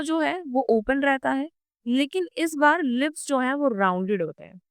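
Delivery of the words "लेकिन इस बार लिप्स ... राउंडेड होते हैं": ordinary talk, nothing marked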